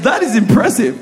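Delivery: laughing admiration. Real, not acted. Pastor laughing at how well he was tricked. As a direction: chuckle while you admire it.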